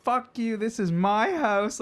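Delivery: put-on very high, slow indignant drunk voice; drunk girl cussing. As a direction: insist drunkenly it's her house.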